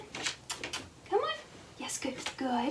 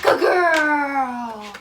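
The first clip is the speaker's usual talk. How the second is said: usual speaking voice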